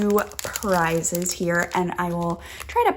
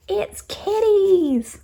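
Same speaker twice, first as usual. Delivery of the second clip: very high, slow squeal of It's kitties; cute-thing voice; 13 semitones higher, 5 dB louder, much slower than usual. Real, not acted. Gushing to viewers over kitty pins, high squeal. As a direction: coo it like seeing a kitten. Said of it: "this voice just comes out".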